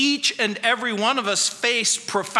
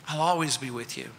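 soft promise; 8 semitones lower, 7 dB quieter, faster than usual. Acted. Quoting Jesus' promise softly.

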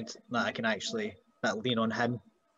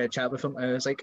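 ordinary talk

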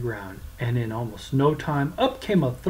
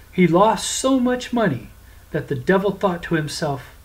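ordinary delivery, nothing marked

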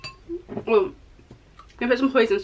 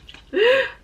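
high sharp gasp; 12 semitones higher, 4 dB louder than usual. Real, not acted. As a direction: gasp at the sight. Sees the spicy sauce.

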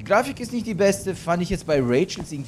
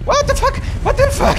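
much higher, louder panicked yelling; startled screams; 5 dB louder than usual. Real, not acted. Gamer chased by the creature again, shrieking in fright. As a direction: shriek it in sudden shock.

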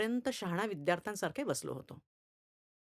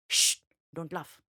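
slow, grave hush; mock-serious calm to the class; 3 semitones lower, 4 dB louder, slower than usual. Narrator mock-seriously hushes the laughing class. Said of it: "गंभीर आवाजात".

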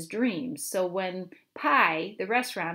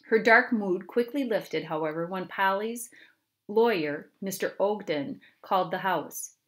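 ordinary delivery, nothing marked

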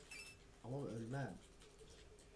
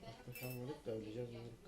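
ordinary talk

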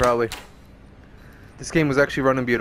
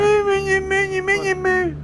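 mock sing-song; much slower than usual. Teases the game character, sing-song.

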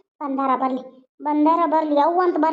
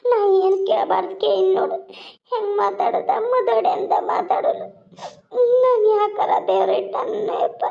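high, slow, stretched-out mock wail; milk the pretend tears, dragging words out; fake sobbing lament; 3 semitones higher than usual. Mother feigning misery to her son, fake sobbing lament. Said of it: "ಯವ್ವ, ಅಳ್ಬೇಡ".

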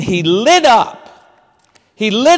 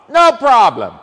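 louder Italian host voice; breezy mock Italian reassurance; Italian maitre d'; 5 dB louder than usual. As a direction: maitre d' coming back to seat them, Italian accent. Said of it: "he came and got us".

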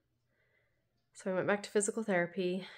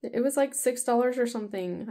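normal talking voice

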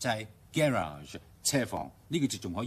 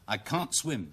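I can't swim quicker, can't as kahn without audible T; faster British reading; 4 semitones higher, slower than usual. Shown as a demonstration. Teacher speeding up to lose the T, faster reading. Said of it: "讀快啲".

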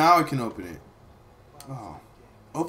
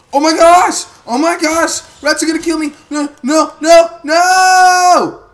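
high loud panicked yelling; panicked shouting; 12 semitones higher, 14 dB louder than usual. Real, not acted. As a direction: scream it in real panic. Rats attacking player.

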